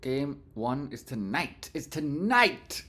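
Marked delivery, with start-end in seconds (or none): 1.8-2.8 slow, loud excited shout. Real, not acted. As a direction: belt it out with glee. Excited stock stream announcement.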